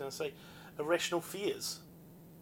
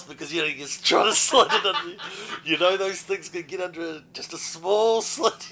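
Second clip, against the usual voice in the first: laughing; 3 semitones higher, 10 dB louder than usual. Real, not acted. Laughing while recalling nervous moment.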